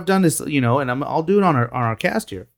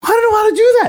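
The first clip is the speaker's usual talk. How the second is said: high, whiny self-mocking squeal; a mock-helpless high wail; whiny panicked protest; 5 dB louder, faster than usual. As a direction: play your younger self in a panic. Mocking his own panicked reply.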